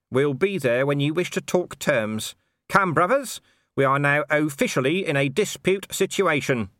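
rally the brothers; pompous union speech. A narrator's reading. Nobby leading the watchmen out on strike, pompously.

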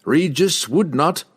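slow, high-pitched objection; deliberate, forceful barbarian protest. A narrator's reading. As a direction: object firmly, word by word. Wulfgar protests slowly in character.

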